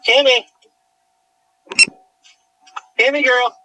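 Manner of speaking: loud, high call across a field; calling the dog. Real, not acted. Owner calling lost dog Cammy in the dark field, loud high calls.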